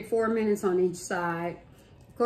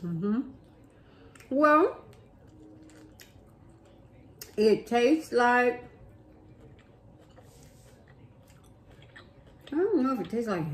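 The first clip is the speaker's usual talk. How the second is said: chewing while talking; speaking between bites of burger; mouth full; 3 semitones higher than usual. Real, not acted. Seen 1: Right speaker bit burger earlier; jaw works while talking, burger in hand.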